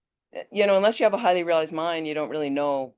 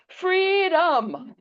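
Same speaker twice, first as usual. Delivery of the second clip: much higher, exultant cry of freedom; joyful cry. Retold or imitated. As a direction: call it out with arms in the air. Shopkeeper cries out with raised arms.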